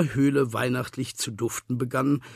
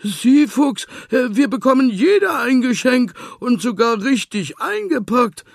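high, loud cheering piglet; jubilant; 12 semitones higher, 11 dB louder than usual. A narrator's reading. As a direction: burst with joy over the gifts. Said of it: "jubelte Schweinchen".

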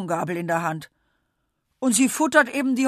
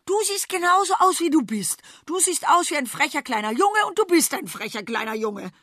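furious chef; 8 semitones higher, 4 dB louder than usual. Acted. Cook scolds the boy back.

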